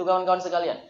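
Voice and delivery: ordinary delivery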